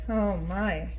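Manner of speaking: ordinary delivery, nothing marked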